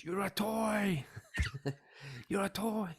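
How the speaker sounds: high, drawn-out cartoon cry; Woody. Woody telling Buzz off in Toy Story, high hammed-up cry. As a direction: stretch out "toy" in a high, exasperated wail. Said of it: "When Woody's back to Buzz".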